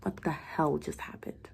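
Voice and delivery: slow whispered disbelief; stunned whisper. A narrator's reading. Doctor alone in the bathroom, stunned whisper. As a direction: murmur it, still shocked.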